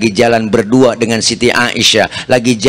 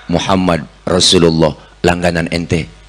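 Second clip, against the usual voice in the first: gentle Prophet; 8 semitones lower than usual. Acted. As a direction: answer humbly from outside. Prophet answers at door. (Nabi menjawab pelan dari luar)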